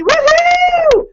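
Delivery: high loud celebratory woohoo; cheer. Real, not acted. Leader cheering her gold medalist, loud woohoo. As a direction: let out a big woohoo.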